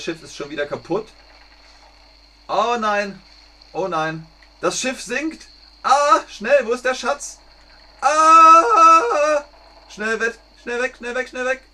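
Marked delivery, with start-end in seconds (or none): 2.5-5.5 dismayed. Acted. Ship sinking in the game. 5.8-7.4 high, loud and rushed; panicked hurry. Acted. Ship sinking, panicked rush. 8.0-9.4 loud high alarmed shriek; panicked scream. Acted. Ship sinking, cry out. 9.9-11.6 high, loud and rushed; panicked hurry. Acted. Ship sinking, panicked rush.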